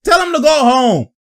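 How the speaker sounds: taunting the defender, high shouted hype; mock hype shout. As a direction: shout it like trash talk. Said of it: "He said".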